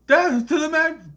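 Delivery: high, loud hammed-up battle yell; hammed-up war cry; mock battle cry. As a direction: scream it like a charging villain. Villain attacks in retelling.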